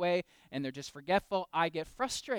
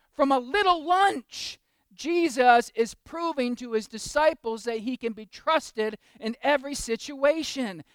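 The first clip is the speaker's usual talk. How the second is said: louder, slow preacher's build; preaching at full force; 9 semitones higher, 11 dB louder, slower than usual. Acted. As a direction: proclaim it to the back pews. Preaching the miracle.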